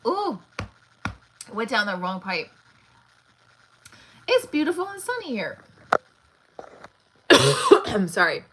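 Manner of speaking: strained voice after coughing; choking. Real, not acted. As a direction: talk through a choking cough. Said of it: "I'm choking".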